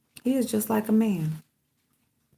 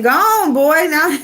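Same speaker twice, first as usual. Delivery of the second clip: higher, louder shoo; shooing command; 9 semitones higher, 6 dB louder, slower than usual. Real, not acted. Owner shooing her dog away, loud and high. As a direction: chase the dog off.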